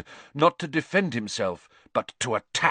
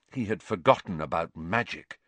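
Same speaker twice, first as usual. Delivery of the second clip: plain reading voice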